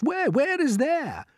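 high, slow demand; bewildered question. A narrator's reading. Everyone questioning the grasshopper, high and bewildered. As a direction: ask it high, stretching the words. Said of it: "they asked".

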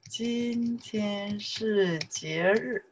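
very slow, every syllable stretched; drawn-out demonstration. Shown as a demonstration. Teacher modelling Chinese for learners, drawn out very slowly.

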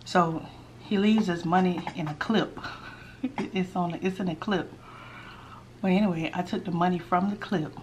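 quiet, hushed gossip. Real, not acted. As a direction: keep it low and secret. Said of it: "I can't talk too loud".

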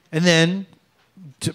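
ordinary talk

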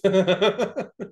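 high, loud laugh; short burst of laughter. Real, not acted. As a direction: crack up at your own story. Laughing at own quoted reaction.

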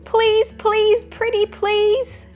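high, drawn-out pleading; tearful begging. A narrator's reading. Tortoise begs the geese to carry him, begging. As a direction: beg like a whining child, acted for a story. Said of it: "begged Tortoise as the tears".

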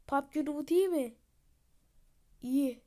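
usual speaking voice

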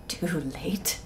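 low, very soft mock question; hushed echo. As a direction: drop right down, small and questioning. Mock echo in the acted exchange.

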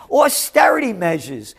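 usual speaking voice

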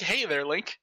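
ordinary talk, nothing marked

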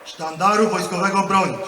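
loud, measured oath line; formal oath dictation. Real, not acted. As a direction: declaim each phrase for the ranks.

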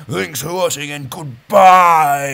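loud call, last word held long; exaggerated sign-off. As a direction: belt out the farewell, stretching "goodbye". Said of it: "well, I'm like".

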